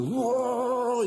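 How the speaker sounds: high, rolled engine roar; car engine imitation.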